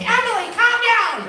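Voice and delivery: put-on high, loud "calm down" to a wild kid; calling out. Dad yelling at a hyper kid to calm down, high and loud. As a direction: shout over the chaos to settle her.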